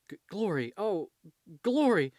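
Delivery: high, loud, urgent call of a name; stammer, then burst out loudly. Acted. Foggy spots Glory at the church, calling out.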